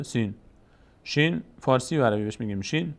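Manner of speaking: ordinary talk, nothing marked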